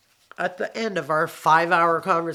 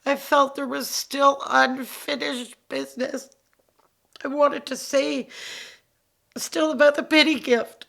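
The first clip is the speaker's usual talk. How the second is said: voice breaking with grief; 9 semitones higher than usual. Real, not acted. Grieving her father's death.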